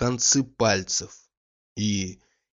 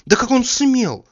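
much higher, louder and slow, outraged; indignant outburst; 13 semitones higher, 7 dB louder than usual. A narrator's reading. Olga fumes to herself about Oblomov, indignant. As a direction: burst out in offended disbelief.

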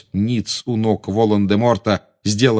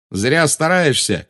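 slow, raised defiant cry; Garri shouting; 7 semitones higher, slower than usual. A narrator's reading. Boy mocks the witch through pain, crying out. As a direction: call it out, voice high. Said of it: "крикнул Гарри".

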